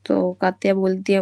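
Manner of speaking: ordinary talk, nothing marked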